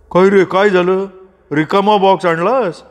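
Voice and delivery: questioning father. A narrator's reading. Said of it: "बाबांनी विचारलं".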